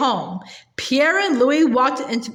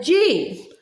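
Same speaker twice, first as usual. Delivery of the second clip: restrained narrated shout; 5 semitones higher than usual. A narrator's reading. Boy steering his team off the road, restrained shout. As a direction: call the turn with lifted pitch only. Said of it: "cracked his whip and shouted".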